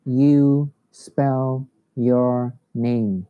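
very slow, careful teacher model; slow model reading. Shown as a demonstration. Teacher rereads the question for the class, very slow. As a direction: lay each word out slowly. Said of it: "Thầy đọc lại nhá".

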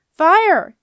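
very loud high alarmed shout; yell. A narrator's reading. Jenna yelling a warning to the skipper. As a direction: scream the warning. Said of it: "yelled Jenna".

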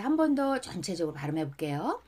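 normal talking voice

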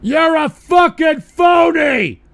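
loud, high, drawn-out accusing shout; outraged, full-voiced heckle; angry yell. Real, not acted. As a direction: yell it out slowly, hitting every word. Streamer angrily yelling insult at someone.

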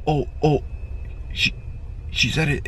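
ordinary talk, nothing marked

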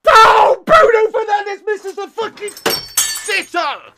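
loud, high cry of disbelief; anguished shout. Real, not acted. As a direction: wail in agony at a missed sitter. Reacts to missed chance.

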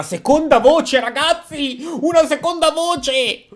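high, rising disbelief; amazed exclamation. Real, not acted. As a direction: announce it to the viewers, astonished. Reacts to a second voice.

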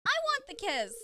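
high, whiny character voice; high pouty voice. Acted. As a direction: pout for the kiss. Voicing game character.

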